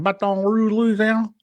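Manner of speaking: mock Louisiana accent, pitched high. Host teasing guest about her lost accent, mock Louisiana twang. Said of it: "how come you don't have an accent".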